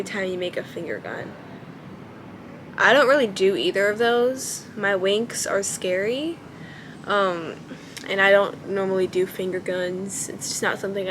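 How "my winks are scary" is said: ordinary delivery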